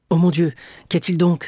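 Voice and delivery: high, loud worried outburst; alarmed cry. A narrator's reading. Dantès alarmed by the sigh, loud cry. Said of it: "s'écria Dantès".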